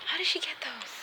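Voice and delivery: hushed whisper; baffled whispered aside. Real, not acted. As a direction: whisper it to yourself in disbelief. Wondering about the glasses.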